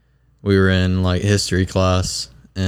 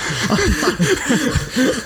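high, breathy giggle at the memory. Real, not acted. Telling friends a funny dance story, high giggle.

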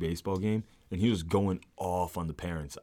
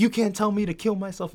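high-pitched imitation of an offended ref; mock indignation; 11 semitones higher, 4 dB louder than usual. Player retells the ref's comeback, mock indignant. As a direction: mimic indignant protest, pitched well up.

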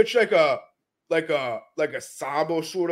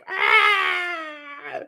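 high, drawn-out mock scream; imitated scream; 11 semitones higher than usual. Imitating a scared grappler stuck in side control, high mock scream. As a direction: wail out a panicked "Ah!". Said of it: "he's just screaming".

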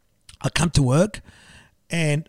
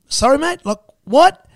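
startled; 9 semitones higher, 6 dB louder, slower than usual. Retold or imitated. Caught off guard by journalist.

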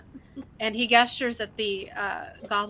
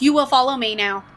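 loud, commanding goblin order; firm, louder in-character command; goblin's command; 8 dB louder than usual. Acted. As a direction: order them to follow, raising the volume. Goblin slams staff, gives order.

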